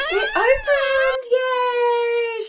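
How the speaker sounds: high bright cheer that it opened; excited. Acted. Teacher cheering to children that the chest opened. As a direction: squeal with delight for the kids.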